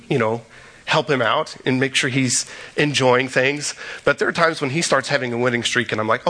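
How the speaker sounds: ordinary talk, nothing marked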